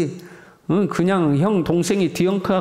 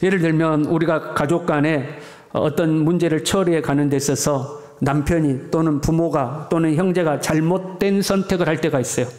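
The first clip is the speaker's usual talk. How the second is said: ordinary talk, nothing marked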